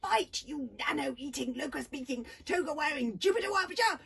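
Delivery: loud, high string of insults; insulting shout. A narrator's reading. Girl taunting Hiccup while fighting, loud and high. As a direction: fling each insult at him.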